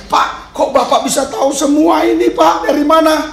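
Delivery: high-pitched, astonished questioning; acted amazement. Preacher voicing police chief asking pastor how he knows, amazed. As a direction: imitate someone baffled, voice lifted high.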